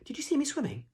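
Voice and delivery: put-on very high childlike squeak; Roo squeaking. A narrator's reading. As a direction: squeak it eagerly, wanting to be admired. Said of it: "squeaked Roo".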